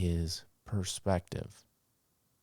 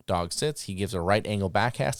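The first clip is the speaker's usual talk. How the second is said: normal talking voice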